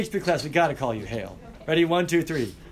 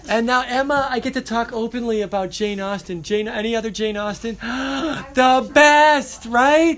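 higher-pitched fangirl gush with a gasp; excited gushing; 6 semitones higher, 5 dB louder than usual. Real, not acted. Teacher to student about a beloved author, gushing. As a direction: gush eagerly, building to a loud high peak.

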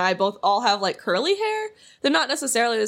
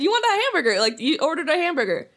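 mock insistent coworker; 6 semitones higher than usual.

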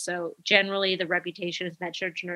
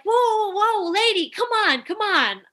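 high, loud alarmed whoa-whoa at the patient; 12 semitones higher, 3 dB louder than usual. Retold or imitated. Nurses stopping a patient scratching, high alarmed whoa. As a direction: pitch it way up in mock panic, louder. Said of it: "we're like".

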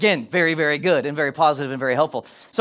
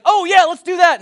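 excited realization; 11 semitones higher, 7 dB louder than usual. Retold or imitated. Couple reacting after prompt.

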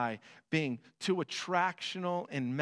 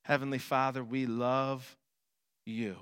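prayer; 6 semitones lower than usual. Acted. Opens prayer.

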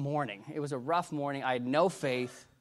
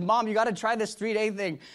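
high, louder imitation of eager pleading; retold enthusiasm, pitched up; excited urging; 8 semitones higher, 5 dB louder than usual. Woman calling her mother-in-law about the method, excited.

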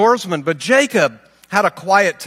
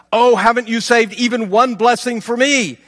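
high, raised-voice protest; desperate plea; 6 semitones higher, 4 dB louder than usual. Acted. Cheated son asking his father for any blessing, desperate plea. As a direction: beg with the pitch held high and insistent.

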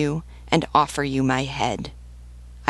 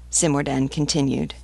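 ordinary delivery, nothing marked

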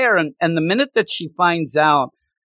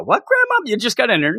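shocked grandson; 8 semitones higher than usual. Retold or imitated. Kazuya reacting to grandma.

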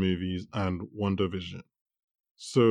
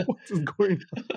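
higher, incredulous retold question; baffled disbelief; 9 semitones higher than usual. Host retelling an actor's baffled reaction, incredulous. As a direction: play up the bafflement.

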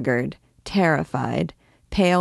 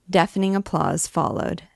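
plain reading voice

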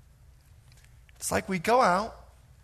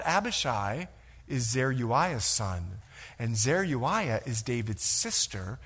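ordinary talk, nothing marked

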